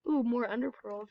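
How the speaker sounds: ordinary talk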